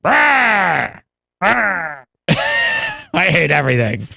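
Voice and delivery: lightly voiced grumpy Penguin growl. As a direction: growl it like a cartoon villain. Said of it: "doing the Burgess Meredith penguin now".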